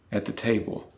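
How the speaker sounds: usual speaking voice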